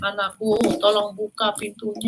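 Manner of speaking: giant faking mother. Acted. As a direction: coax like a disguised giant mother. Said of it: "Raksasanya bisa meniru suara ibunya, loh, seperti ini".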